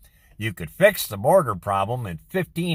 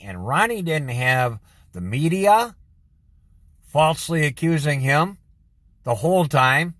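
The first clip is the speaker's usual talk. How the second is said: ordinary delivery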